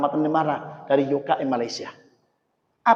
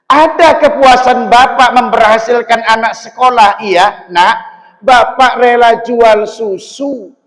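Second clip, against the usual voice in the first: loud, raised voice of a proud father; proud father voiced; 8 semitones higher, 14 dB louder than usual. Preacher voicing a father telling his children. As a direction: speak up as the father who sold milk to school his kids.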